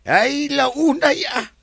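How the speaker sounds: high, loud, drawn-out gush; emphatic exclamation. Acted. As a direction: exclaim slowly at the top of the voice. Emphatic exclamation about the resort.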